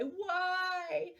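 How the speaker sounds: high questioning cry; plaintive why. A narrator's reading. Teacher quoting people who cry why, high. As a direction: lift it high like a lament.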